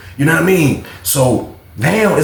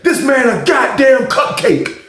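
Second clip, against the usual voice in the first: mocking yell; 7 semitones higher than usual. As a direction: jeer at the beaten man while calling. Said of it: "He was like".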